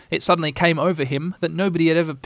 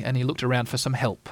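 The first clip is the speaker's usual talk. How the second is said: ordinary delivery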